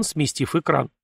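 plain reading voice

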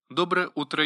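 pleasant female robot voice; gentle assistant AI voice; care robot. A narrator's reading. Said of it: "раздался приятный женский голос робота-помощника".

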